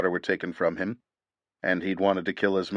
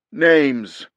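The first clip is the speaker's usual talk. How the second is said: loud, higher one-word demand; commanding shout; 7 semitones higher, 7 dB louder than usual. A narrator's reading. Commando demanding their names, loud and high. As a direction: snap out the order. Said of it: "the unknown voice commanded".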